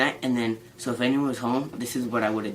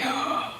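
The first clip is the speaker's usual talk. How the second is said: happy gasp. Real, not acted. Finds quesadillas are there.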